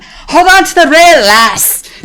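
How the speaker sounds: put-on high, loud bellow of a drunk Irish bloke at a gig; hammed-up shout of a burly Irish fan; drunk Irishman yelling. Drunk Irish dude at the rail yells to her, loud hammed-up bellow. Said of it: "burly Irish dude".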